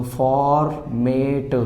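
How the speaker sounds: very slow "format" split in halves; slow split reading. Shown as a demonstration. Teacher showing students how to read it in halves. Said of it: "आधा आधा करा इस word को".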